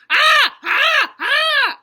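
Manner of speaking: high, very loud repeated screams; scariest scream. A narrator's reading. Clown girl scaring Grandma, full screams. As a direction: scream three times at full blast. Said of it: "I did the scariest scream".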